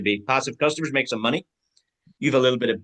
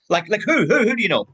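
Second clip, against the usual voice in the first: eager asking; 8 semitones higher, 5 dB louder than usual. Acted. Neighbour role-play, asking eagerly.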